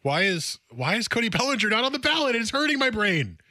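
high-pitched, frustrated complaint; exasperated bafflement. Real, not acted. Baffled by missing ballot name.

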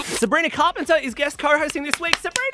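high, loud radio introduction; big hyped welcome, soaring on the name; excited announcement. Real, not acted. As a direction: build to shouting the guest's name. Host announces guest co-host.

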